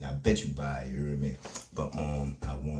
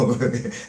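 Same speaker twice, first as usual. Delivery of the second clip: sudden loud, high laugh; real laugh; 6 semitones higher, 8 dB louder than usual. Real, not acted. Streamer urging people to get their words out fast, sudden laugh. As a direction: burst out laughing mid-sentence. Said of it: "like, I'm laughing".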